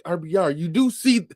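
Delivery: mock exasperated host; host pleading with guest, high disbelief; incredulous self.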